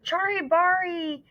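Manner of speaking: high, loud, breathless gasps; gasping. Acted. Chang gasping the name to his mother, breathless and high. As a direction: force each word out with no air left. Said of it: "he gasped".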